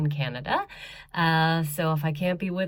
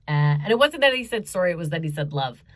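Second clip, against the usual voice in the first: ordinary delivery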